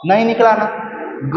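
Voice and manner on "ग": "ग" छोटा और बिना ह की ध्वनि के; "ग" जल्दी, कम साँस के साथ बोलिए; अल्पप्राण का उच्चारण. Shown as a demonstration. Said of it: "अंत में ह कार की ध्वनि नहीं निकला". ("ग" का स्पष्ट अल्पप्राण उच्चारण)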